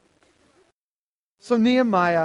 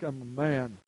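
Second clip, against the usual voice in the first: lower, gruff mock macho voice, lightly done; mock tough guy; 4 semitones lower than usual. Preacher mocking the no-crying manly creed, gruff mock. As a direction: parody the stoic man who won't cry. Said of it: "kind of growing up like".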